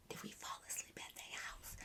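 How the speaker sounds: barely audible unvoiced whisper; acted whisper. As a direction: whisper it secretly to a friend. Secretly asking friend among sleeping boys.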